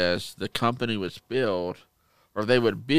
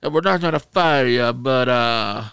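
louder, raised mock Italian mobster voice; Italian mobster accent; 3 semitones higher, 6 dB louder than usual. Manager sparing the cheats, Italian mobster accent. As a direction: imitate an Italian mobster boss. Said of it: "he had the Italian mobster accent".

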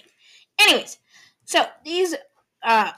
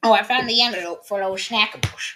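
ordinary delivery

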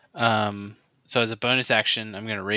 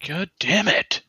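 louder, higher exasperated curse; frustrated curse; 7 semitones higher, 4 dB louder than usual. Real, not acted. Player annoyed at the miss, louder frustrated curse. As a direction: curse the bad roll in frustration.

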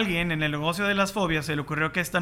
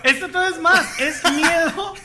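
high, loud, through laughter; laughing; 9 semitones higher, 6 dB louder than usual. Real, not acted. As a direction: barely get it out laughing. Laughing while reading.

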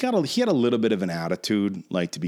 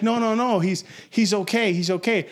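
high imitated reassurance; mock pleading, pitched up; urgent placating; 7 semitones higher than usual. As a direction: act out a friend trying to defuse a fight. Friend intervenes again.

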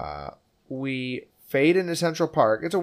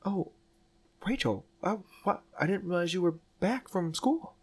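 raised-pitch, lightly acted awkward Bill; playing Bill; 7 semitones higher than usual. Reenacting the movie for a co-host, as flustered Bill with a higher voice. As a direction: fumble the words like a flustered man meeting his old love. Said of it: "I'll be Bill".

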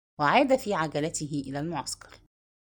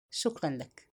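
a short, cold thank you, softer; curt and cold; 4 semitones lower, 7 dB quieter, slower than usual. A narrator's reading. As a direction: brush him off with a curt thanks. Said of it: "باقتضاب وبصوت لا يخلو من جفاء". (شكر مقتضب بجفاء)

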